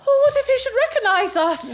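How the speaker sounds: high, loud panicky fear; panicked young wife. Acted. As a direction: blurt out the fear of being found out. Panic at being recognised.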